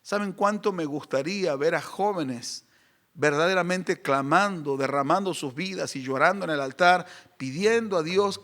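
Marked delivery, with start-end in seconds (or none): none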